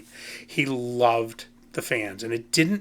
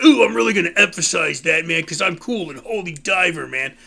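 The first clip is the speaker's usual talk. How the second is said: mocking poser fan; 6 semitones higher, 6 dB louder than usual. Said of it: "it's like".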